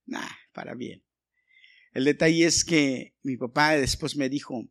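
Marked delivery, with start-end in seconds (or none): 0.1-1.0 whispered aside. Real, not acted. Joking aside after laugh.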